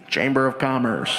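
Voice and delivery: very low, level put-on voice; fake official. Prankster answering through the hotel door, fake official. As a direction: pose as an official in deep monotone.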